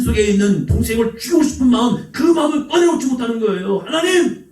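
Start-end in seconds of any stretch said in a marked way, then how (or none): 3.8-4.5 crying out. Retold or imitated. Cain crying out to God.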